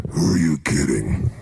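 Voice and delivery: low, slow sneering inner voice; doubting inner voice. Retold or imitated. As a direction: drop way down and scoff slowly. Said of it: "this voice in your head goes".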